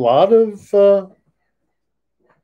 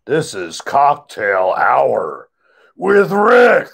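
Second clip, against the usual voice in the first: TV host intro; 3 semitones lower than usual. Pretend. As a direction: announce it like a show host. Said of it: "show host intro".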